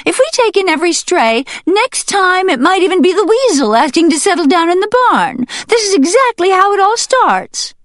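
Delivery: very high, loud, fast hen voice; rant, flapping and alarmed; heated hen. Acted. Hen warning the whole barn, very high, loud and fast. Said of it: "the hen shot back".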